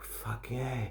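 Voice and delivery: very soft, low reply; hushed approval. Retold or imitated. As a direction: savor it quietly to yourself. His hushed reply to her offer.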